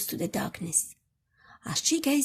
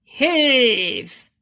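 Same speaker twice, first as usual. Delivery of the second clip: loud call to pull together; loud rhythmic heave cry; heave shout; 9 dB louder than usual. Acted. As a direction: haul on the rope, shout it out. Rescuers heaving the rope together.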